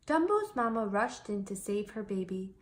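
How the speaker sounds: ordinary delivery, nothing marked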